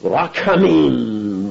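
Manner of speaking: Rachamim said slowly in Hebrew; Hebrew word. Shown as a demonstration. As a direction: draw out Rachamim with a guttural ch. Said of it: "the word for mercy".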